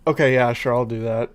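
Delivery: usual speaking voice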